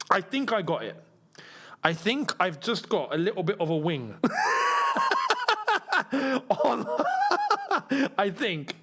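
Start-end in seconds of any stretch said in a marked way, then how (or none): 4.2-6.6 high shrieking laugh. Real, not acted. Laughing after swatting mosquito. 6.9-8.1 high shrieking laugh. Real, not acted. Laughing after swatting mosquito.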